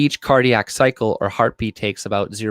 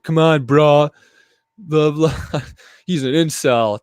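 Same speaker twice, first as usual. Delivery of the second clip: mocking whiny voice; 6 semitones higher, 4 dB louder than usual. Mocking the Indian guy's comment.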